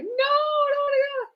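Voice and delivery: high, pleading no; pleading refusal. Retold or imitated. At the edge of going to Earth, high pleading refusal. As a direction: beg not to be sent away. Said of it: "and I was like".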